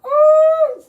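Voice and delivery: long, high, loud "ooh"; loud whoop. Real, not acted. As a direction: wail out a drawn amused whoop. Host whooping over caller's story.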